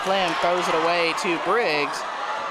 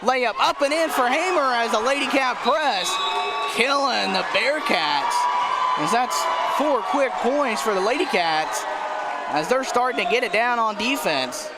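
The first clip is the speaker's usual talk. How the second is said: excited play-by-play; 7 semitones higher than usual. Real, not acted. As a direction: shout the steal and score with real excitement. Fast break points called live.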